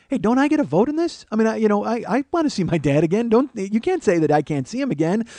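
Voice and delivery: put-on high, loud child protesting; indignant little boy, pitch way up; pleading kid. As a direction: imitate your younger self objecting from the backseat. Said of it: "I'm like".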